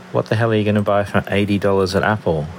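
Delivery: normal talking voice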